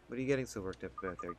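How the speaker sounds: ordinary delivery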